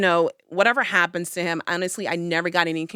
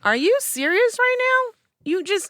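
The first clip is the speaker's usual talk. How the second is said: much higher, louder indignant outburst; outraged disbelief; 4 dB louder than usual. Retold or imitated. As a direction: shoot up in shocked outrage. Ex messages years later.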